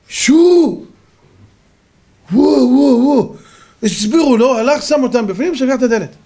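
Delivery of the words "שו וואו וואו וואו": high, loud drawn-out whoops; imitated high "shoo" and "whoa" calls; imitated whooping. Storyteller acting out the lions being herded, imitated whooping.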